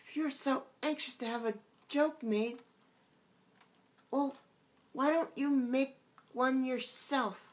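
put-on a girl turning the joke back on him; young Alice, a bit cross. A narrator's reading. Alice answering the gnat.

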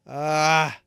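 loud, high panicked cries; yelling in real panic. Real, not acted. Lost the item, panicking.

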